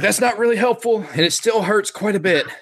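high, put-upon character voice; high whiny voice. Acted. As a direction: whine it like a wounded small creature. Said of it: "he's like".

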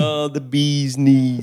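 put-on slow, slurred drunk drawl; town drunk. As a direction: play the sloppy town drunk. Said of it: "you're drunk again".

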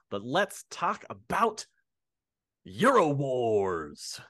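high build then slow, drawn-out title; dramatic reveal. Acted. Host finally launching the topic, slow trailer voice.

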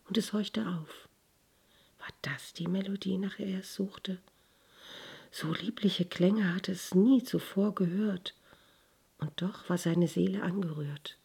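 hushed, breathy bedtime narration; whispered storytelling. A narrator's reading. (geflüstertes Erzählen)

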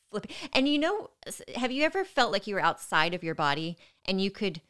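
ordinary talk

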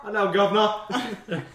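laughing mock British greeting; British accent. Shown as a demonstration. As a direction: echo a hammed-up British hello. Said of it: "starts sounding a little bit British".

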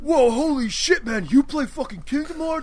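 hammed-up awe; mock hyped gamer. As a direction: play a stunned fanboy.

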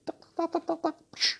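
high clicking tuck-tuck then a psh; clicking noise. Retold or imitated. Imitating someone leaving, quick tongue clicks and psh. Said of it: "he went".

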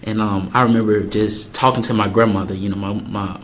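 normal talking voice

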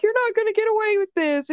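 very high, whiny imitation; mock indignant threat. Slapped girl threatens teacher, mock indignant.

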